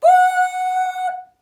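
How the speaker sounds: loud high horn imitation; ship horn boop. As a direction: honk it like a ship's horn. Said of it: "Went the horn".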